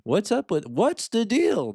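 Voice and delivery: a light, rising Seinfeld-style "what's the deal"; stand-up comic whine, pitch climbing to the deal; Jerry Seinfeld. Retold or imitated. Asking ChatGPT in a mild Seinfeld impression. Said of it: "That's my bad, uh, Jerry Seinfeld impression".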